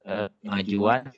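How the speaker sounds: ordinary talk, nothing marked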